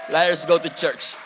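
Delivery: laughing. Real, not acted. Repeats punchline laughing.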